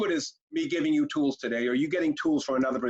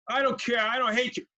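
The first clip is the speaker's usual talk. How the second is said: put-on defiant kid; 7 semitones higher than usual. Shown as a demonstration. Kid defiantly answering parent.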